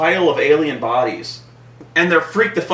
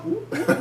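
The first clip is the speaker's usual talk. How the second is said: high soft whooping noise; light playful sound effect; imitated pop-up sound effect; 12 semitones higher, 7 dB quieter than usual. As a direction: mimic the movie creature popping up. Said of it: "when the eye pops up".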